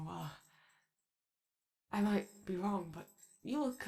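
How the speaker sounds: usual speaking voice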